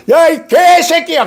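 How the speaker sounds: crude insult. Retold or imitated. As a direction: bark it like a lowlife. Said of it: "천한 사람이 하는 소리고".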